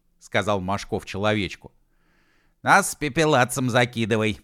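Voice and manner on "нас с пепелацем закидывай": louder, higher insistent order; lightly hammed-up demand. A narrator's reading. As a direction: demand to be sent along. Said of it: "потребовал У Ф".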